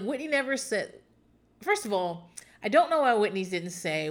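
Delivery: ordinary talk, nothing marked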